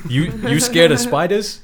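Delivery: mock a scaredy-cat as a little prankster; taunting kid. Co-host playing Reggie teasing Tommy, taunting.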